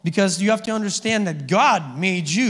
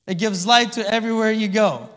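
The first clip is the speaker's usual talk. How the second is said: usual speaking voice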